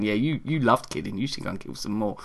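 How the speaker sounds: low, soft goading undertone; Mister Whiskers. Acted. Evil cat urging owner to kill, low and quiet. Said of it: "with Mister Whiskey's going".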